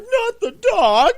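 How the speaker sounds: high, loud wail of despair; anguished cry. Retold or imitated. Movie fan horrified by the dog's death, high anguished cry. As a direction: cry out as if the dog is dying before you. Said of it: "I'm like".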